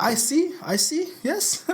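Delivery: high, slow, sly voice; mock Putin. Host voicing Putin eyeing the Super Bowl ring, high and slow. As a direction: stretch it out slyly. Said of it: "I was like".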